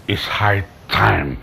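very low, slow and soft; grim resolve. Acted. Betrayed uncle resolving to end it, grim resolve. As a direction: pronounce it slowly under the voice.

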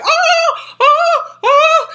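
high, loud sobbing ah-ah-ahs; panicked screaming. Retold or imitated. Hitting the cop's chest, high sobbing wails. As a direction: wail in bursts while flailing.

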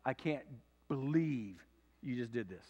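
low, deflated grumble of disbelief; imitated dismayed men. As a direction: drag it out in mock dismay. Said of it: "all the men are gonna say".

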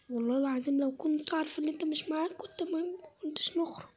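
whispered complaint so he won't hear. Acted. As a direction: hush it under your breath, annoyed. Complaining secretly to friend. (همس متضايق)

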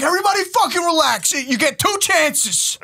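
high, frantic peacekeeping, mock-earnest; exasperated dad. Dad calming hysterical sons, exasperated. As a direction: hurry the order, then stretch out the ruling.

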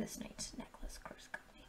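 soft whispered aside. Real, not acted. Vlogger whispering as she shows off a necklace from Chris. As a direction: whisper it to the camera.

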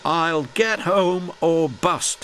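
high, slow, determined vow; brave promise. A narrator's reading. Broken old engine vowing to get home, high and brave. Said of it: "he promised bravely".

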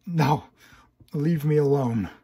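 loud, desperate scream. Acted. Hero screaming at the nightmare to leave him alone, loud scream. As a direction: yell at the nightmare to go. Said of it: "you screamed instinctively".

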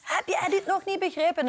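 high-pitched, mild mock yell; mock shouting in capitals. Spelling out an aggressive message to a boyfriend, mild mock shout. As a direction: imitate an all-caps angry text. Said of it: "dan hoop ik dat hij doorheeft dat ik roep".

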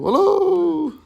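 high drawn-out echo yell; mountain call. Retold or imitated. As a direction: call out across a valley. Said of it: "köyde dağların orada bağırırdım".

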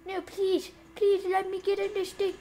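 high, insistent begging; frantic pleading. Real, not acted. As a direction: beg to be let in. Begging to get in car.

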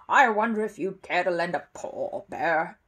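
a lightly voiced casual ask; casually. A narrator's reading. Neighbour asks the bear for help, casually. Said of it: "he said casually".

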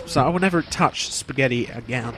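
ordinary talk

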